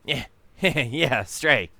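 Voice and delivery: chuckling at the word stray; amused laugh. Real, not acted. Player laughing at the cowboy's line.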